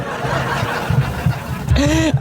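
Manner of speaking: real amused scoff at the opponent; mocking laugh. Real, not acted. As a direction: laugh off the idea in disbelief. Laughing at god crying idea.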